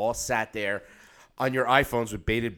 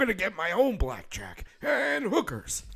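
put-on high, gruff robot-style old man; Bender impression; 10 semitones higher than usual. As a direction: brag like Bender from Futurama. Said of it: "sounds like Bender from Futurama".

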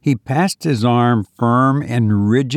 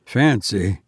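usual speaking voice

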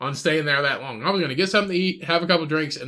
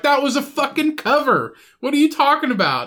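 mock indignant outburst; 8 semitones higher than usual. Bar patron arguing about Kennedy, mock outrage. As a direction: scoff at him like he's an idiot.

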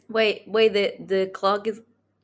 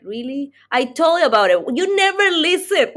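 mock indignant; 7 semitones higher than usual. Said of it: "They're like".

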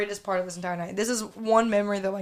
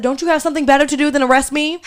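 louder, fast cheeky taunt; sassy retort; 5 semitones higher, 9 dB louder, faster than usual. Retold or imitated. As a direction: sass the cop quickly. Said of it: "I said".

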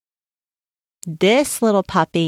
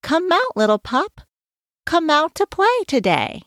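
high sing-song call to come out and play; friends calling out; 9 semitones higher, faster than usual. A narrator's reading. The friends outside call the sick pup, high and sing-song. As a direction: call up to the window like eager puppy pals. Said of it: "and begin to say".

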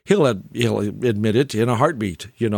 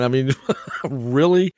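ordinary talk, nothing marked

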